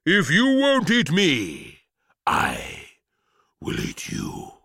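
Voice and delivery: a monster relishing its revenge, slowing down; menacing cabbage. Acted. Giant cabbage vows revenge on the boy, menacing.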